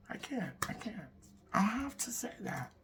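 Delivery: a real whisper, no voice at all; whispered aside. Real, not acted. Stopping herself from cursing, whispering to the audience. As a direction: breathe it under your breath.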